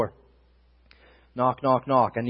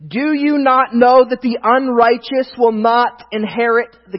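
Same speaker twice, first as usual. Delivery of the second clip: raised, deliberate preacher's reading; scripture reading; 6 semitones higher, 7 dB louder, slower than usual. Real, not acted. Preacher reading First Corinthians to the church, emphatic proclamation. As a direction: proclaim the verse, punching each item in the list.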